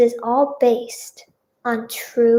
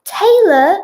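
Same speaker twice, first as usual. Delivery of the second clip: high, raised call of a name; gentle call; 8 semitones higher, 7 dB louder than usual. A narrator's reading. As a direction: call through a closed door. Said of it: "he called gently".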